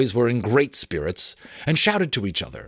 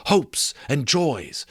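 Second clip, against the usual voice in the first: usual speaking voice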